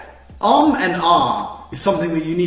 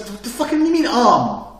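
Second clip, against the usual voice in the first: exasperated retort; 8 semitones higher than usual. Retold or imitated. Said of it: "it's like".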